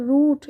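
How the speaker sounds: রুট with a long held vowel; teacher's demo of রুট, lingering on the vowel; drawn-out oo. Shown as a demonstration. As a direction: stretch the oo in রুট before the t. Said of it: "একটু টেনে বলবে".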